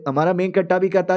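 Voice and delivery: ordinary delivery, nothing marked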